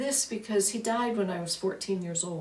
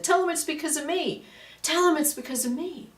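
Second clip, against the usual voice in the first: put-on higher, insistent little-girl plea, said twice; inner little girl; 8 semitones higher, 4 dB louder than usual. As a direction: plead like a small child tugging your sleeve. Her inner little girl speaks up.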